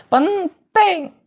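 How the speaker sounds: high, loud, drawn-out answer; emphatic class answer. Acted. Teacher answering whether memorizing matters, high and emphatic.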